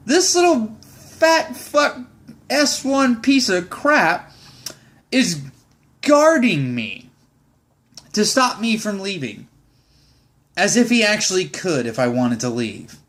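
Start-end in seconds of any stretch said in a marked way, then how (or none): 0.1-7.1 slow contempt. Real, not acted. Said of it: "So yeah".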